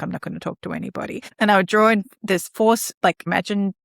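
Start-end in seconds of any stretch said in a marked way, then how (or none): 0.0-1.2 soft, fast, mumbled refusal; imitating a shut-down child; a shut-down child refusing to talk; sullen shutdown kid. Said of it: "shutdown mode".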